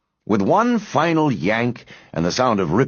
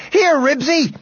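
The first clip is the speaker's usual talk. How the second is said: high-pitched yell for the dog; 4 dB louder than usual. A narrator's reading. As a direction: yell to call your dog back. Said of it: "he yelled".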